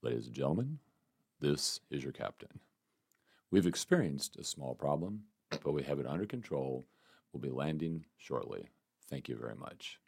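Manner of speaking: low gravelly airline captain voice; pilot announcement. Retold or imitated. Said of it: "since I got kinda like a low, grovelly voice".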